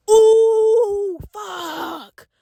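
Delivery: loud drawn-out ooh, then a hushed curse; horrified realisation, dropping off at the end; dismayed. Retold or imitated. Shocked reaction on seeing the bruise.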